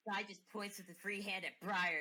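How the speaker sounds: ordinary delivery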